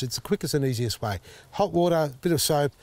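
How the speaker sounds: normal talking voice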